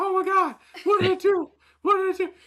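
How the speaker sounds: very high, hammed-up alarm; retold panic. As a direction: squeal it in mock panic. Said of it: "forty-five seconds of panic".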